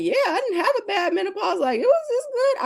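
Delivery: mock the lucky carefree women; breezy laughing. Quoting women with easy menopause, high laughing voice. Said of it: "they're like".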